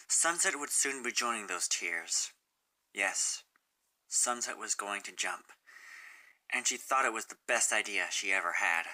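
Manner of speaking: plain reading voice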